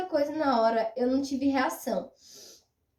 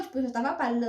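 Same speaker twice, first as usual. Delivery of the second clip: normal talking voice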